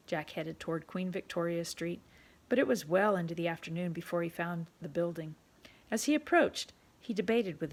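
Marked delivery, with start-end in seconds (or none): none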